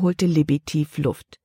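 usual speaking voice

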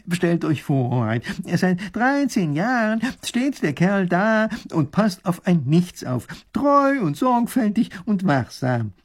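slow, high, emphatic wonder; amazed professor. Acted. Professor explaining to the Grand Duke, slow and amazed. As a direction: marvel at each point.

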